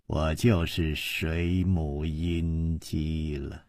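slow, quiet scorn broken by laughter; cold mockery. A narrator's reading. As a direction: sneer slowly and low, with a dry laugh. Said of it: "这个女子就冷笑起来". (冷笑着低声慢慢嘲讽)

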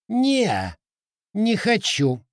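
high rubbery squeaky-toy voice. Acted. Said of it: "пискнул Рыжетоп".

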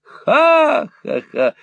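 put-on high, slow old man's cackle. Old Arthur cackling at Ralph, high and slow. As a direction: cackle gleefully, rubbing hands. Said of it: "откликнулся Артур, потирая руки".

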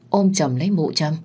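usual speaking voice

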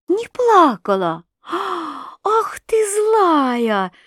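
high, slow indignant cry; cried out. A narrator's reading. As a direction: cry out in mock outrage. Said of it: "Закричала княжна".

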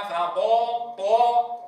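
طا with lips rounded, alif toward oo; alif leaning to waw. Shown as a demonstration. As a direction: purse the lips on فطا. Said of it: "فيقول مثلًا فطال".

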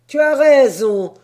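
high, loud hearty yes; loud agreement. Acted. Sailor agreeing with his mate, loud hearty voice.

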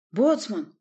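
hoarse, panting call; out of breath. A narrator's reading. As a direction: gasp it out after running. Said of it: "прохрипел рядом запыхавшийся голос".

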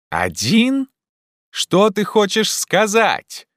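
high, drawn-out puzzled question; calling up. Acted. Legrand questions Jupiter's meaning, calling up. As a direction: call up in puzzlement.

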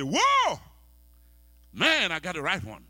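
high, loud, thrilled whoa; excited exclaim. Acted. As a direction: burst out over the right one. Imitating an excited groom.

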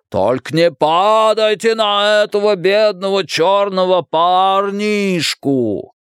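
slow, high, hammed-up wailing caricature; theatrical drawn-out holler; put-on Jim voice. A narrator's reading. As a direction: draw out each word in a high singsong. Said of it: "кричал Ричи".

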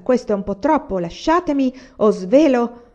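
high, loud threat to tell; exasperated protest. A narrator's reading. Agata to the pair pinching her, exasperated. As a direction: protest loudly, stretching toward the threat. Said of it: "imprecò la bella ragazza".

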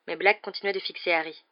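plain reading voice

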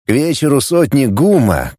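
plain reading voice

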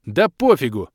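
loud exploding retort; outburst. A narrator's reading. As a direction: blow up at them. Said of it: "взорвался я". (взорваться громко)

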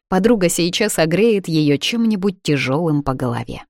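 usual speaking voice

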